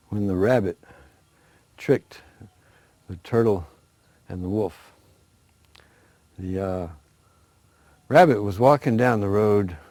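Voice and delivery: plain reading voice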